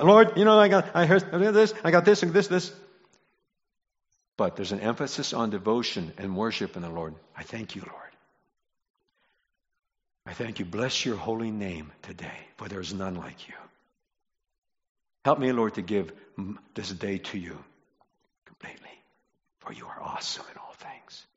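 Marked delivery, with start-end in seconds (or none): 0.0-2.7 high-pitched, rattled-off imitation of praying; mock hasty prayer. Mimics a hasty request-list prayer. 7.3-21.2 very soft, lowered worshipful voice; devotional prayer. Shown as a demonstration.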